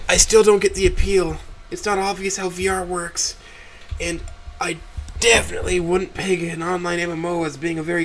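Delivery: high-pitched voice of a sceptical daughter; unimpressed daughter. Acted. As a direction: complain to Mom, unconvinced. Voices Cynthia doubting the anime.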